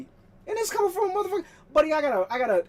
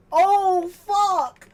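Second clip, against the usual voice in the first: excited swear; 8 semitones higher, 7 dB louder than usual. Real, not acted. Nostalgia hits on seeing console.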